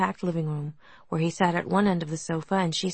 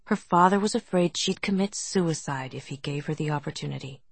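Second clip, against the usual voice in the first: ordinary delivery, nothing marked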